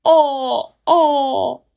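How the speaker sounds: loud, high yells of pain; yelling wolf. Acted. Scalded wolf yelling in pain as he runs off. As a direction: yell out as if scalded. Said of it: "yelling in pain".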